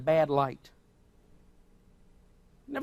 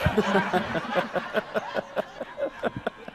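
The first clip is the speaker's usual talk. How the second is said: laughter; 2 semitones higher than usual. Real, not acted. Laughs at own joke.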